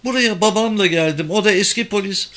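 high, anxious explaining voice; uneasy. A narrator's reading. Uneasy woman explains herself to police, nervous. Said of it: "sesi hala tedirgindi".

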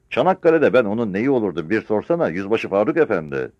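loud, high, daring retort; defiant old veteran. Acted. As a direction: challenge the officer with a threat. Old soldier dares the captain. (meydan okuyan yaşlı çavuş)

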